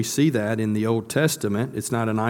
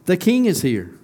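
higher and louder, a joyful cry; excited proclamation; 6 semitones higher, 3 dB louder than usual. Acted. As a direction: announce the king's arrival with rising joy. Preacher repeats the crowd's cry.

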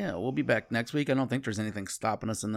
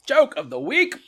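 announcer call; 8 semitones higher, 8 dB louder, slower than usual. Pretend. Segment title called out.

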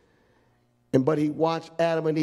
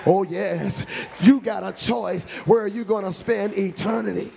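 ordinary delivery

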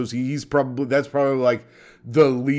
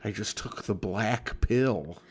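mock incel juror; 7 semitones lower, 10 dB quieter than usual. Podcaster mimics the incel jurors, low and subdued. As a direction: imitate a glum juror, low and soft.

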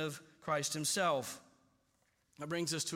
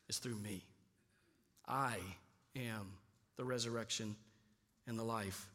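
hushed declaration; 8 semitones lower, 8 dB quieter than usual. Retold or imitated. Quoting Jesus to Martha, hushed.

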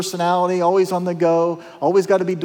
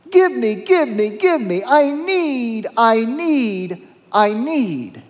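a lightly voiced needy crowd, higher; mock grasping plea, higher pitch; 6 semitones higher, slower than usual. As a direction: whine it like people who keep asking.

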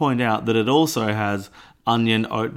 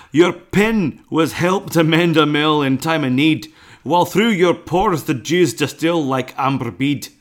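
hammed-up Scottish brogue, higher and louder; Scottish accent; 4 semitones higher, 4 dB louder than usual. Reading a Burns poem aloud to his cohost in a loud Scottish accent. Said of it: "impossible to read without doing a Scottish accent".

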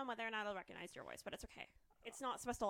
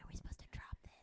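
whispering; 10 dB quieter, much faster than usual. Real, not acted.

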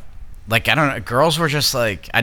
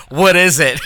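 teasing imitation; 6 semitones higher, 4 dB louder than usual. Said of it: "You were like".

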